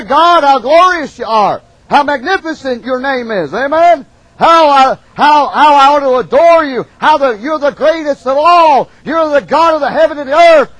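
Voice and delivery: loud, high, exalted adoration; fervent praise. Shown as a demonstration. Preacher showing the congregation how to praise God, loud and high. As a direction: pour out praise to God at full voice. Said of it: "We ought to say".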